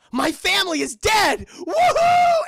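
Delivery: high, loud whoop; gleeful shout. Retold or imitated. Kevin cheering that his family is gone. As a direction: scream it with joy.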